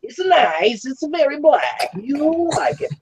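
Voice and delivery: high put-on Borat accent, drawn out; Borat impression. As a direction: do the Borat voice for the guys. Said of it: "[name], do the voice".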